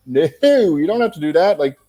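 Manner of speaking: nervous rookie begging a star not to trouble himself; flustered protest. Retold or imitated. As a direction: insist he doesn't need to stay.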